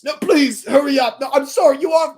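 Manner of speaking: high, loud shout after her; desperate shout. Acted. Stuck driver shouts at Flora to please hurry. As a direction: yell at her to hurry back.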